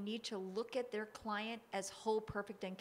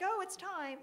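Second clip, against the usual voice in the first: mock breezy; 12 semitones higher, 5 dB louder than usual. Mock casual quitting voice.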